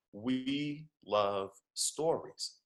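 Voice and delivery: very slow, word by word; slow emphasis. Real, not acted. Speaker driving home the driver's lesson, slow emphasis. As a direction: draw out each word to land the point.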